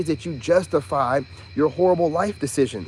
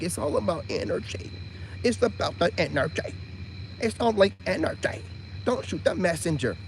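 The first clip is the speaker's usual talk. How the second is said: mock reader quote; 7 dB quieter than usual. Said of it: "they'll say things like".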